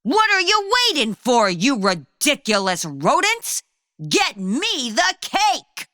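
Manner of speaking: high, snappy mock yell at the mice; bossy hammed-up yell. Cheddar yells at his mice, mock angry yell. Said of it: "Cheddar yelled to the mice".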